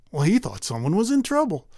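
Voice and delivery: ordinary delivery, nothing marked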